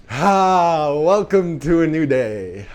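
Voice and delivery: high, slow, glowing welcome; sunny and uplifted, slowly savoring; bright morning greeting. Shown as a demonstration. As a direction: greet the new day with delight. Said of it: "or is breathing like".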